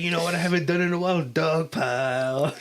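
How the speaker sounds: excited brother-in-law. Retold or imitated. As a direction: rush it, then stretch "Dog pile". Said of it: "And he's like".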